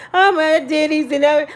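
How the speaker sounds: put-on pitiful whimpering; fake crying. Speaker telling the man where they are, fake-tearful. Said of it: "I act like I'm started crying".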